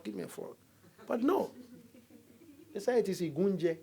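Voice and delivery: usual speaking voice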